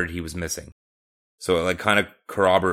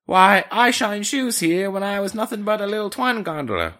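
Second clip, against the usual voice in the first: high-pitched, hammed-up Cockney old-days yarn; mock Cockney impression; 12 semitones higher, 3 dB louder than usual. Co-host riffing as the passenger, exaggerated high Cockney. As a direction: overdo a squeaky Cockney reminiscence. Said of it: "This is not an impressionism show".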